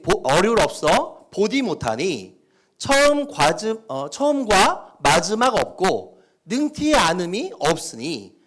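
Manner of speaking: slow, loud North Korean dialect reading. Shown as a demonstration. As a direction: recite slowly in a northern dialect. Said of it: "북한 사투리를 쓴다고 생각하고 읽으시면".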